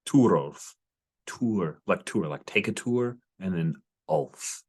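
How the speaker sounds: slow, split "Tour" then "Ulf"; Turulf as tour-ulf. Shown as a demonstration. Game master spelling out the name Turulf as tour plus Ulf. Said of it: "That's how I'm gonna try to say it".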